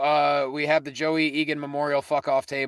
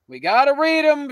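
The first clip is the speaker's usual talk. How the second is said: exasperated shout; 8 semitones higher, 6 dB louder than usual. Acted. Reluctant, then bursts louder.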